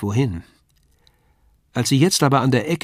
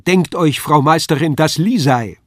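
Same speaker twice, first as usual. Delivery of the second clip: higher and louder, full of delight; excited; 4 semitones higher, 6 dB louder than usual. A narrator's reading. Paul tells the landlady who has come, excited. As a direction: share the joyful news. Announcing Lisei to the Meisterin.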